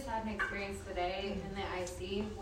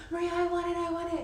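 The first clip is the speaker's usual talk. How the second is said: much higher mock child whining; to coworker Maria, mock childish demand; whiny want; 10 semitones higher, 5 dB louder, faster than usual. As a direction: reach and beg like a toddler. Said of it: "I was reaching up and I was like".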